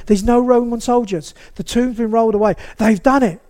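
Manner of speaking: building from worried to loud, high panic; Mary's rising panic. A narrator's reading. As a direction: voice Mary frantic at the empty tomb. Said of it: "a blind panic".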